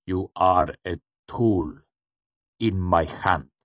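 slow, measured, gently menacing; gentle interruption. A narrator's reading. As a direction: gently put them in their place, word by word. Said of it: "the shadowed man interrupted gently".

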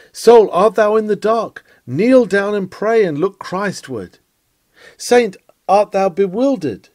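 preacher raising his voice to reach each troubled listener; fervent preaching call. Acted. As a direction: call out to the lost from the pulpit.

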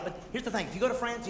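normal talking voice

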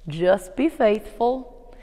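very slow, lightly drawled Southern imitation. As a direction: drag it out like the old professor. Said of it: "guess what his answer was".

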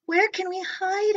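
high worried question. Acted. Gork asking where to hide, high and worried. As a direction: ask in a panic. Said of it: "asked Gork".

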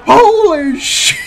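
high, loud mock shriek; imitating a shocked shout. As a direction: ham up his stunned yell. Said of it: "you're like".

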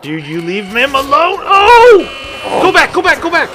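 high loud panicked yelling; panicked shout. Real, not acted. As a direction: shout them back in alarm.

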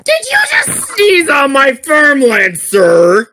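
high, loud indignant accusation; hammed-up offended farmer; mock outrage.

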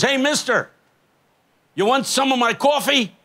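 high-pitched call of a street man. Retold or imitated. Street man hails a passing preacher to offer coffee, high-pitched call. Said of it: "yelling and screaming at somebody".

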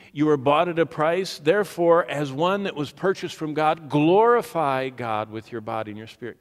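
fiery preaching. Acted. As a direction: drive the scripture home to the crowd.

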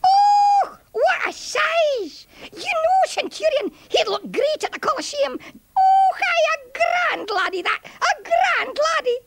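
very high squeaky Scots puppet voice; tiny Scottish soldier marvelling; little soldier Brutus, awed. Acted. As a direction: pipe up in amazed admiration.

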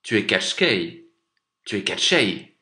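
loud cry of recognition; exclaiming. A narrator's reading. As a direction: call out his name in accusation. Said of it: "s'écria Ivan".

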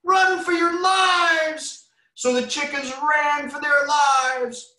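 very high, slow, loud cry; panicked chicken shriek. Acted. Reading aloud, the chickens panic and run. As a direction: scream for everyone to flee.

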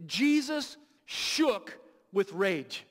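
slow, drawn-out, every word weighed; slow weighty emphasis. Shown as a demonstration. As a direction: let each word land slowly. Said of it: "The Greek translation literally means this".

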